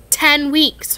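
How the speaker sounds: sharp, loud snap; snappy. Acted. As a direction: snap it back at him. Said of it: "Hermione snapped".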